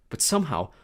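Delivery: ordinary delivery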